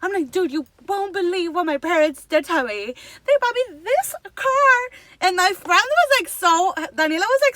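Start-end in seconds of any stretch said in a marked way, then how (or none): 0.3-4.8 retold rant climbing higher and louder; hammed-up outrage, building to the end; mad venting. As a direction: vent indignantly to a friend. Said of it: "I was so mad".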